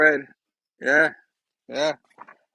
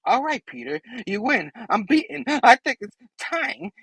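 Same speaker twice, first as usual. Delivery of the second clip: high, softer villain feigning surrender; Goblin; 6 semitones higher, 4 dB quieter than usual. Acted. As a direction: concede in a small, raised villain voice. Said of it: "The Goblin's like".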